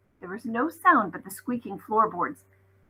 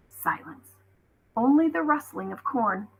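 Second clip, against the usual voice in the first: ordinary delivery, nothing marked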